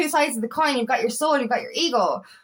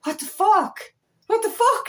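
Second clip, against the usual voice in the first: high-pitched incredulous outburst; acted disbelief; 9 semitones higher than usual. Retelling how easy the breakout room was, incredulous. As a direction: replay your disbelief, pitch leaping up.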